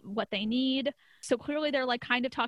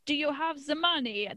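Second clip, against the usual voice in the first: higher-pitched, badly faked Russian accent; mock Russian accent; 4 semitones higher than usual. Host imitating intimidating Russian guys in a mock Russian accent. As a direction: put on a dodgy Russian tough-guy accent. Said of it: "I don't know what that accent was".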